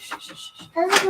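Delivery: hushed, breathless shh repeated; shushing. Real, not acted. Mother shushing her son so viewers aren't disturbed, hushed.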